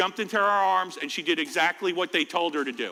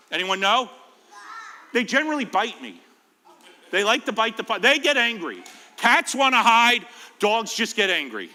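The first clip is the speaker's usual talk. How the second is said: ordinary talk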